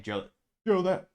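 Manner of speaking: half-hearted Obama voice that breaks off; Obama impression. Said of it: "they have Obama saying".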